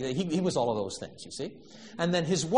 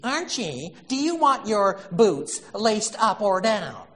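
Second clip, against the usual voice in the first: high, whining and pleading, louder; whiny wife voice; 7 semitones higher, 6 dB louder, slower than usual. Retold or imitated. Wife whining to Archie about his boots. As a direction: whine it up like a doting wife. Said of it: "in this whiny voice".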